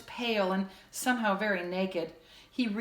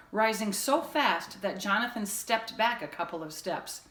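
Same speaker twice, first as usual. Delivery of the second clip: ordinary delivery, nothing marked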